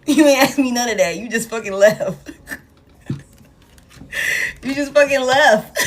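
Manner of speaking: laughing through the words; can't stop laughing while saying it. Real, not acted. Laughing at ex who left.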